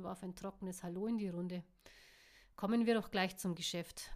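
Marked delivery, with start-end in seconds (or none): none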